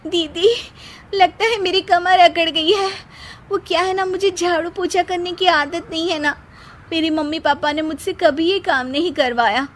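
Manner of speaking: high, whining fake complaint; whiny sister-in-law. Seema fakes pain to dodge chores, high whine. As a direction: play up a stiff back, high and whiny.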